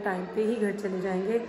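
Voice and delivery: normal talking voice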